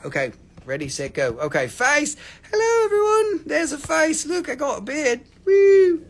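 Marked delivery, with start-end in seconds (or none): 2.5-5.2 high-pitched playful hello; high comic voice, easing down; silly high greeting. Pretend. Silly greeting on showing face. 5.4-6.0 loud, high "woo"; short playful whoop. Pretend. Whoop after showing face.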